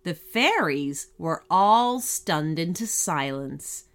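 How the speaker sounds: ordinary delivery, nothing marked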